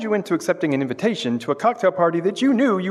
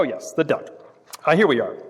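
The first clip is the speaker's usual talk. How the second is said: ordinary talk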